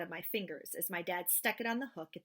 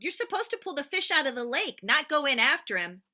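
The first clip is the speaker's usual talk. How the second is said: loud teasing; 6 semitones higher, 9 dB louder than usual. Acted. Dad teasing son in lake.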